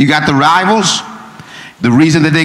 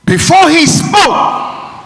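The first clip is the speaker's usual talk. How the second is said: preached shout; 13 semitones higher, much slower than usual. Acted. Preacher builds on repeated words.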